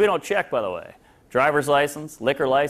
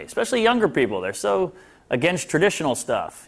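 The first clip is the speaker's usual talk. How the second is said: ordinary talk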